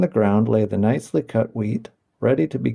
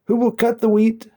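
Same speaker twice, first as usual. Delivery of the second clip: brisk call; 11 semitones higher than usual. A narrator's reading. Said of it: "calling briskly".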